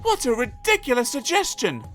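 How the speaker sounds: high-pitched scoffing; scornful. A narrator's reading. Young companion scorning the idea, high scoffing. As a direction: dismiss it in a high character voice.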